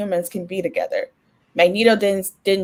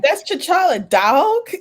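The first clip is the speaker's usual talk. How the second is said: imitating toddler; 9 semitones higher, slower than usual. Imitating daughter naming poster.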